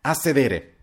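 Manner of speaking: short, decisive command; resolute order. A narrator's reading. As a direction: order them firmly to sit. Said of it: "ordinò allora Papiano risolutamente".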